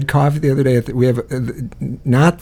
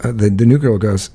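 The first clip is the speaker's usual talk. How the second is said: ordinary talk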